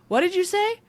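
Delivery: imitated "what did you just say?" reaction; incredulous retold question. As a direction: act out a baffled friend.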